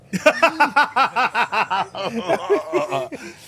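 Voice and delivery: high, loud imitated whooping cackle; imitated bench celebration. Teller mimics Sal going crazy behind Seattle's bench, wild high cackling. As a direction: mimic a crazed, high-pitched celebrating laugh. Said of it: "There's Sal behind the Seattle bench going".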